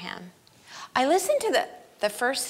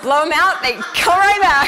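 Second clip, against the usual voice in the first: laughing; 6 semitones higher, 9 dB louder than usual. Real, not acted. Joke about kids returning home.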